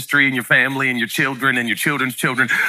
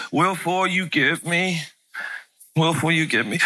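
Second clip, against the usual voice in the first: put-on little kid's accent; 4 dB quieter than usual. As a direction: lightly mimic the boy's jumbled words. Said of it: "with that little accent".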